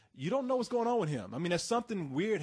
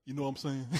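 low actor imitation; 6 semitones lower than usual. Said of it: "No, he'd be like".

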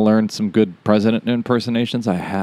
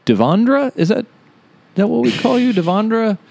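high mock polite voice; 12 semitones higher than usual. Mock HR introduction.

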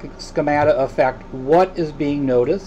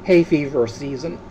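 ordinary talk, nothing marked

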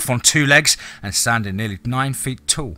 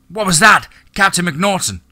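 alarmed, higher and louder question; startled neighbour; 8 semitones higher, 6 dB louder than usual. Acted. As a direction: call out in alarm.

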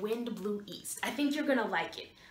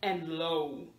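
low voice; 6 semitones lower, 4 dB quieter than usual. Shown as a demonstration. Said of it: "Opposites are like high".

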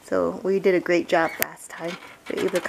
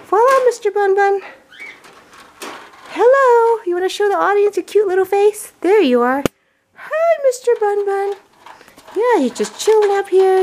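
put-on high, loud baby-talk cooing to a bunny; pet talk; 10 semitones higher, 5 dB louder than usual. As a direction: coo sweetly at the pet on camera.

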